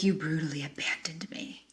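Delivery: imitating a friend, low-voiced and soft; hushed accusation. Friend accusing newly married friend, hushed accusation. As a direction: drop to a near-whisper to accuse.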